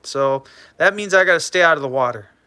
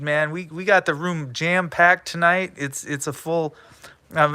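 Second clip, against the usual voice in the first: ordinary talk, nothing marked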